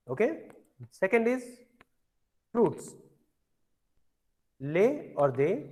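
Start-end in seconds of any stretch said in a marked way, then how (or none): none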